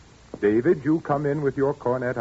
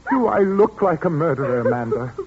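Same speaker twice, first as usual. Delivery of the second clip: anguished outcry; 8 semitones higher, 3 dB louder than usual. Acted. Accused father pleads with Amanda, anguished and raised. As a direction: beg her to say he's no murderer.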